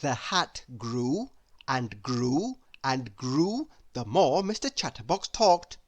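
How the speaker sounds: plain reading voice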